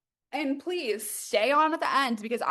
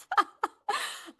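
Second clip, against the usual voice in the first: high emotional giggle; happy laugh; 10 semitones higher than usual. Real, not acted. Host moved by chat, happy laugh. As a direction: laugh out the relief.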